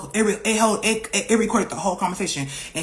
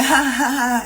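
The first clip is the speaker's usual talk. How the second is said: imitating mocking women laughing, higher; a mimicked background cackle; mimicking the girls laughing at her; 5 semitones higher, slower than usual. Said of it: "bitches in the background laughing".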